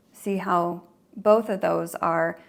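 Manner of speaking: ordinary talk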